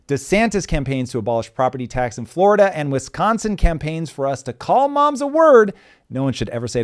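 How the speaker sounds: high, loud headline hype read; news intro read. Acted. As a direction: punch each headline like a host.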